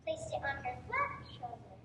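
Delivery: plain reading voice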